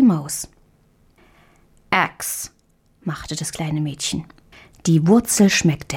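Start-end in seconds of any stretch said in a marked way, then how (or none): 1.9-2.5 breathy voiceless grimace of disgust; a child's soft yuck at a sour taste; disgusted ugh. A narrator's reading. Marie tastes the bitter root, voiceless disgusted ugh.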